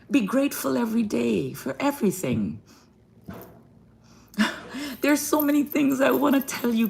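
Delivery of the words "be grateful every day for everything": ordinary talk, nothing marked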